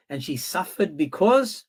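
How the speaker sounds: ordinary talk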